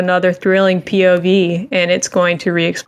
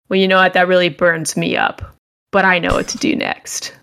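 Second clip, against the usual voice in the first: ordinary delivery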